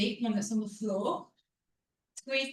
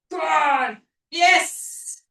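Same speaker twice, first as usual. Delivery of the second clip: encouraging cheer; 5 semitones higher, 10 dB louder, much slower than usual. Real, not acted. Coach cheering the class on.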